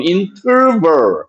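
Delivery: 인터벌 slow, with English R and V; exaggerated English pronunciation. Shown as a demonstration. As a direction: draw out 인터벌 as "in-terr-verr". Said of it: "미 발음".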